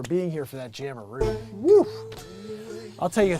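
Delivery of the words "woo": loud, high "woo" cheer; short burst of a whoop; excited whoop. Real, not acted. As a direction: let out a loud celebratory whoop. Cheer while thanking the audience.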